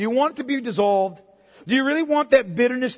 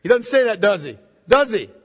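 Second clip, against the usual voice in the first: ordinary talk, nothing marked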